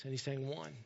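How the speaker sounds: ordinary delivery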